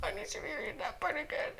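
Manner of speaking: high, breathless giggly gushing. Real, not acted. Reader gushing over the fic between laughs, high and giggly. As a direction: squeal through the laughter.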